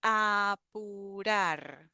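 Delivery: apurar stretched out, a-pu-rar with a clear U in the middle; teacher drawing out apurar; very slow model word. Shown as a demonstration. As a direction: drag out apurar syllable by syllable. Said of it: "So we have the U in the middle".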